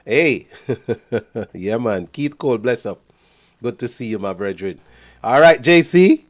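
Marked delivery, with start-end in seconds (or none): 5.2-6.3 loud, high greeting; loud call-out. Real, not acted. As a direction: call out to the caller on the line. Calling out to a caller.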